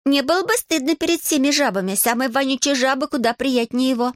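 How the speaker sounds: put-on high-pitched disdainful girl's voice; lofty, high-voiced mockery; scornful girl. A narrator's reading. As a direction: sneer at him, pitch lifted well up. Girl scorns boy, comparing him to toads.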